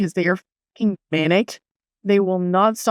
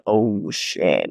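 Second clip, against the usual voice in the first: imitated dread; 12 semitones lower than usual. Woman listener realising it's toxic shock, low imitated dread. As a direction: voice dropped very low. Imagined reaction of women listeners.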